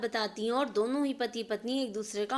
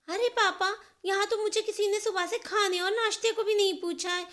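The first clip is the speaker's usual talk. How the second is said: put-on much higher, louder complaining girl; whining daughter; 9 semitones higher, 4 dB louder than usual. Neha complaining to her father, high and whiny. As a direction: protest to dad nobody fed you.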